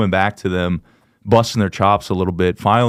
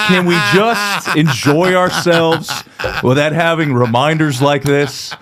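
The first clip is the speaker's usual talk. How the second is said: high, drawn-out pleading through laughter; hammed-up wounded appeal, laughing, slow; mock exasperated plea; 8 semitones higher, slower than usual. Comic voicing the killer in the room, mock exasperated.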